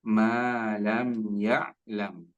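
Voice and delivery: Ma lam ya'lam with ma stretched two beats; long ma. Shown as a demonstration. Teacher corrects the student's ma. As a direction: draw out the ma slowly. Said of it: "Maknya panjang".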